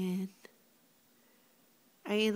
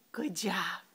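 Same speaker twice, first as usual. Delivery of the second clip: whispered gasp of praise; whispering; 4 semitones lower than usual. Real, not acted. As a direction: breathe it out as a whisper.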